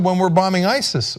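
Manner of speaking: ordinary talk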